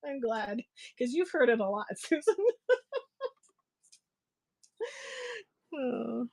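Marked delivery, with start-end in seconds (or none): 2.4-3.3 high laugh. Real, not acted. Laughing with a friend.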